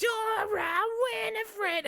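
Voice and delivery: high shrieking witch voice. Retold or imitated. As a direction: screech it like a witch. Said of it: "a bit of the witch voice".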